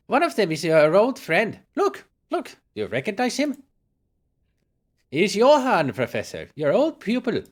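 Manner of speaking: high, loud housekeeper talking to an invalid; coaxing housekeeper. Acted. Housekeeper showing professor his old pupil, high and coaxing. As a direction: coax recognition, high and emphatic.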